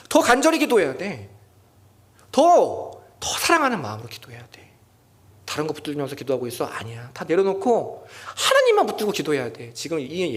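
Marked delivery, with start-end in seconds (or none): 0.1-4.7 louder and higher, pressing the word 더; urgent exhortation. Acted. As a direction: press the congregation hard, stressing 더. Preacher voicing God's urging.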